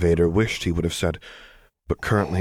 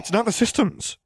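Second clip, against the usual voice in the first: sudden, very high-pitched outburst; blurted out. A narrator's reading. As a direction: blurt it before you can stop yourself, pitch leaping up. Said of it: "Luke blurted out".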